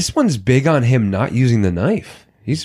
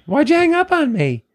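high mocking friend voice, much higher pitch; mocking Annie; 12 semitones higher than usual. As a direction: mimic a smug rude caller in a squeaky pitch. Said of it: "and the phone rings again".

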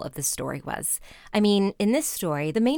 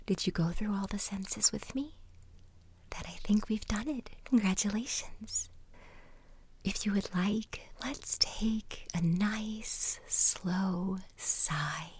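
slow, soft whispered guidance; guided relaxation whisper; 6 dB quieter, slower than usual. Acted. Guide leading listeners through the senses, whispered relaxation. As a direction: whisper the listener gently into calm.

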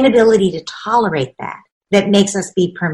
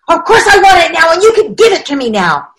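put-on a higher, louder whiny child insisting; demanding child; 8 semitones higher, 7 dB louder than usual. Child whining at parent for money now. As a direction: play the kid demanding it now.

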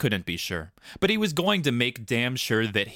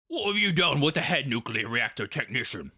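demanding Hermes; 5 semitones higher than usual. A narrator's reading. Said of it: "Hermes asked".

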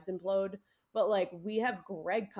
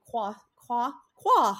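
badly attempted French accent, rising louder; 4 semitones higher, 8 dB louder, much slower than usual. Shown as a demonstration. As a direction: try French sounds and overshoot, clumsily. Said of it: "I can't do a French accent".

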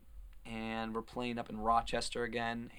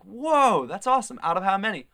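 higher, louder retold amazement; impressed reaction; 8 semitones higher, 10 dB louder than usual. Friends reacting to a race result, excited and impressed. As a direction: act out an excited "whoa".

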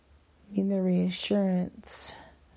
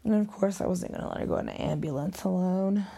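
normal talking voice

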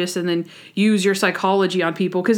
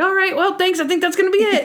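much higher, eager to get out; hurried Randy; 11 semitones higher, faster than usual. Retold or imitated. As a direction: voice Randy desperate to go. Randy rushing to leave.

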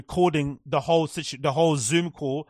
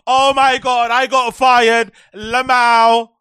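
loud, slow, higher mock wail; mocking her reaction; 5 semitones higher, 8 dB louder, much slower than usual. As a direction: ham up a dramatic online meltdown. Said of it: "Straight away got fired and like".

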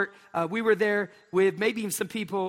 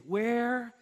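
slow soft pleading; 2 semitones lower, 5 dB quieter, slower than usual. Retold or imitated. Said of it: "they say".

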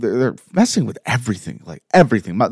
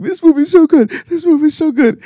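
much higher, squealing the words; mock fanboy gushing. Mocking how the whole episode sounds, a high squealing gush. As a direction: parody your own gushing. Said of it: "this episode was gonna be me saying".

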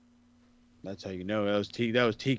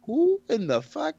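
mock bafflement; 14 semitones higher, 5 dB louder than usual. Retold bafflement at booking.

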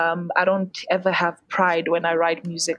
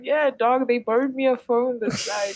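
high, casual jokey reply; playful mock answer from the grave; joking reply; 5 semitones higher than usual. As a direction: toss it off like a dark joke. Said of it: "I'm like".

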